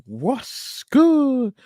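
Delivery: high, stretched-out catchphrase greeting; hyped shout-out. Real, not acted. Host greeting newcomers in the chat, hyped shout-out. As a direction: draw it out way up high.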